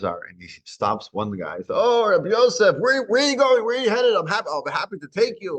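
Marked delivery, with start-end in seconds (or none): 1.8-5.6 much higher, louder eager welcome; delighted wagon driver calling out high; eager greeting. Acted. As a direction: gush over the honored rabbi.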